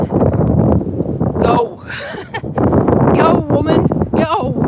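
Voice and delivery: a hint of a barking commander; hammed-up order to hurry; bossy radar voice. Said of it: "rain radar basically said".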